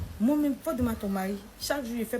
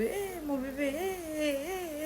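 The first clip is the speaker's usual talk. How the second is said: put-on higher, cooing old grandmother voice; grandma cooing; 8 semitones higher, 4 dB quieter, much slower than usual. Grandmother picks up the baby, cooing higher. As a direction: coo to the baby like a fond grandma.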